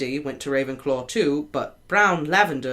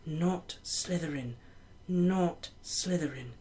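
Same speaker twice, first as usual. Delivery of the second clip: much softer, slow inner plea; pleading thought; 10 dB quieter, slower than usual. A narrator's reading. Harry silently begs the Hat, soft plea. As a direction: beg silently, under the breath.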